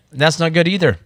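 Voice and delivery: a second worried verdict on the oil; alarmed pilot. Retold or imitated. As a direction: pilot watching oil hit the windshield, mock alarmed. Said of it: "he's like".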